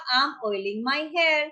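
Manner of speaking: drawn-out teaching delivery; slow demo phrase; slow model phrase. Shown as a demonstration. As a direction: stretch it so learners can follow. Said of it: "तो क्या आएगा".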